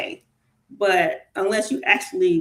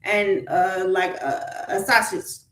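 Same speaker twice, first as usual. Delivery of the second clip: ordinary talk, nothing marked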